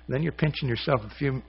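normal talking voice

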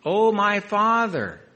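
high, slow, drawn-out cry. Acted. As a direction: wail it out to your father. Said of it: "He's crying out".

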